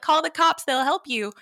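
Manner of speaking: high, loud imitation of an insistent lady; hammed-up high-voiced helper; other lady. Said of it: "she's like".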